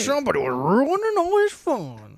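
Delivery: high, drawn-out mocking whine; mock complaint. Teasing a friend about Jake having fun, mock complaint. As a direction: swoop up high and drag it out, teasing.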